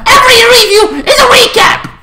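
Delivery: high, screechy yell; mock outburst. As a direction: shriek it in mock exasperation. Mock outburst at own format.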